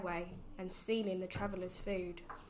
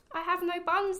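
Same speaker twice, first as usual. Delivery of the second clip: high, louder squirrel voice; nervous squirrel; 9 semitones higher, 5 dB louder than usual. A narrator's reading. Squirrel answering the robber rat, high and startled. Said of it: "the squirrel replied".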